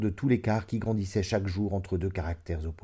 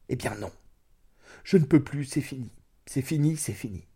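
raised outburst that falls away; vehement refusal; 4 semitones higher than usual. A narrator's reading. Wife refusing to go back, understated vehemence. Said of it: "avec violence".

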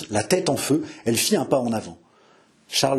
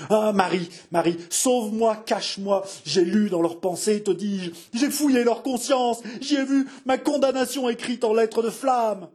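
high-pitched panicked raving, climbing higher; unguarded frantic outburst; 9 semitones higher than usual. A narrator's reading. King begging Marie to hide him, rising panic. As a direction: beg for shelter, then build to hysteria. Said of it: "sans prendre la précaution de baisser la voix".

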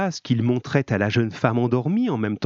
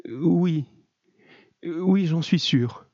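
high, halting woman's voice; tentative yes in a raised voice; hesitant answer; 6 semitones higher, much slower than usual. A narrator's reading. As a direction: answer uncertainly, feeling for the words. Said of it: "Elle hésita quelques secondes".

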